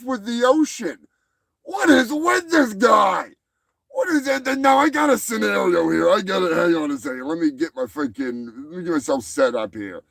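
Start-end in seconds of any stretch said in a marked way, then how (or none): 1.6-4.6 exasperated shout. Real, not acted. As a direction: throw up your hands, exasperated.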